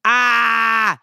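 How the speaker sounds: pained wail of grief; a short wounded wail; anguished cry. Retold or imitated. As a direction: cry out as if it hurts. Anguish over the Red Wedding.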